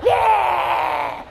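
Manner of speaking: high, drawn-out mock yell; hammed-up, slow high-pitched roar; intense pasta fan. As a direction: cheer like a fanatic about grandma's sauce. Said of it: "if you get intense about, like, pasta".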